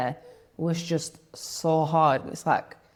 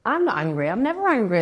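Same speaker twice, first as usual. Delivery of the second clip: offended insistence; 6 semitones higher, 3 dB louder than usual. Retold or imitated. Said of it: "I get, get really offended".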